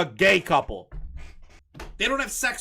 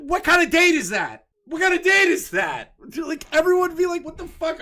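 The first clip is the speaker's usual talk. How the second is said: high, loud mock-outraged shouting; comic high-pitched exasperation; incredulous outrage; 10 semitones higher, 5 dB louder than usual. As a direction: cry out in disbelief at the absurdity. Acted outrage at imagined date.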